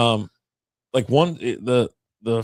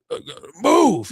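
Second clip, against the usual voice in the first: rude demand; 7 semitones higher, 6 dB louder, slower than usual. Retold or imitated. Rude kid pushing past on plane.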